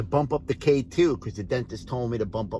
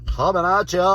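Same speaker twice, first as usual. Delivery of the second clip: higher, louder signoff shout; loud catchphrase; 8 semitones higher, 9 dB louder than usual. Real, not acted. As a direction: punch out the catchphrase.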